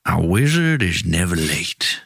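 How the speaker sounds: slow, low Southern wizard; Tennessee drawl. Acted. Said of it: "like Matthew McConaughey, like, Tennessee".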